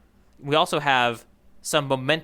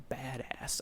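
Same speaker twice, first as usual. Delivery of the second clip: badass dropped to a near-silent mumble; sheepish mutter; 3 semitones lower, 16 dB quieter than usual. Real, not acted. Host awkwardly saying a swear on a clean show, hushed. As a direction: say badass barely above a breath, embarrassed. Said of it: "I felt weird saying it".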